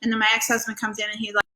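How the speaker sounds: normal talking voice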